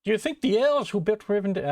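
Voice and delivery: first attempt at a Scottish Samwise; Scottish Sam voice. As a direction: try Sam with a Scottish lilt. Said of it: "ah, why is he Scottish?".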